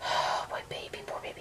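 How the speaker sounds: very soft, breath-only murmur to a pet; hushed, sorrowful soothing of a sick bird; tender whisper. Real, not acted. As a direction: whisper it with a sigh, pitying. Comforting her sick pet bird.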